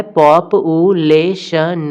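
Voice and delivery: पॉप-ऊ-ले-शन slowly, with a clear break after each syllable; slow syllable split. Shown as a demonstration. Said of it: "चार syllable दिखाई दे रहा है".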